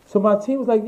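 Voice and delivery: ordinary delivery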